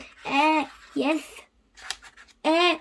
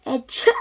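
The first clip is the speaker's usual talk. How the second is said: sneeze; 4 dB louder than usual. Acted. Doctor Cat sneezes.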